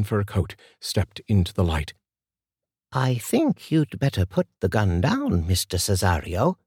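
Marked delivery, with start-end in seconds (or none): 2.9-6.6 put-on composed older woman Martha. A narrator's reading. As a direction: calmly warn the gunman as an old lady. Martha voiced in the story.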